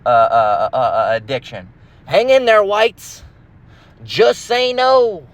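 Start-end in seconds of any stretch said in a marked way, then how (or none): 2.1-5.3 high, slow mock-concerned voice; higher sarcastic fake concern; mocking sympathy. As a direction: fake pity in a raised voice. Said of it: "it's like Dave Chappelle in that joke".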